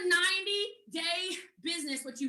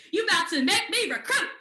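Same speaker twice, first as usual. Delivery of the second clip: loud, high mock exclamation; retold outburst; 4 semitones higher, 8 dB louder than usual. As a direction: burst out as the realization hits. Same realization, now loud and high.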